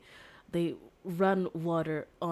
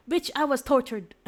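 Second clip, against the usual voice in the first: higher, louder furious retort; indignant outburst; 7 semitones higher, 8 dB louder than usual. Acted. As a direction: fire it back, outraged. Girl snaps at the defender.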